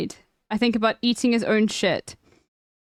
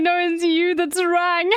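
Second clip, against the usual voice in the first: high voice close to tears of laughter; real helpless laughter; laughing; 7 semitones higher than usual. Real, not acted. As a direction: squeak out the punchline while cracking up. Streamer quotes punchline while laughing.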